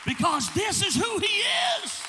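fervent sermon climax; full-force preaching. Acted. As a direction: build the congregation up. Preaching over music.

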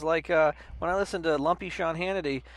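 normal talking voice

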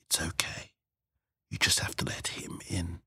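very soft, breath-only coaxing; soothing whisper. Acted. Chairwoman coaxes the tearful hostess, whispering. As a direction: whisper it to comfort her.

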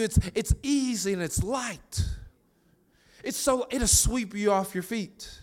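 high, eager voice of God offering to carry the load; God's gentle invitation. Acted. Voicing God offering a trade.

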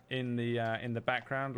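ordinary delivery

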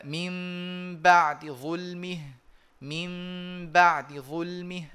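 من بعد ظلمه slow, the dal of بعد clipped and hushed; short soft dal. Shown as a demonstration. Teacher modelling ikhtilas for students. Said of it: "آتي بدال قصيرة الزمن بصوت منخفض".

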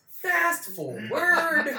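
very slow, high, loud stretched words; hammed-up singsong skip ahead; drawn-out announcement. Real, not acted. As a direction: stretch it out like a showy transition.

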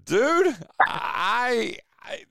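high burst of disbelief; startled exclamation; surprised. Real, not acted. Reacting to joke.

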